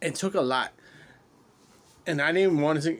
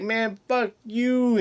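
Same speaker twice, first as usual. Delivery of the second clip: high, slow mock yell; playful yell; 9 semitones higher, 5 dB louder, much slower than usual. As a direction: shout it at a buddy in jest. Barracks banter, mock insult.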